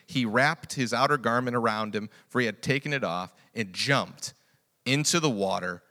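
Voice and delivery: ordinary delivery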